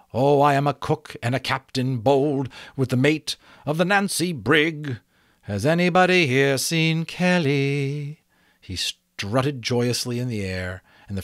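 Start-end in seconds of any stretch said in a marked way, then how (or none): 0.1-8.2 higher, louder singsong butterfly voice. A narrator's reading. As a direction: chant it like a jaunty old song. Butterfly singing old songs.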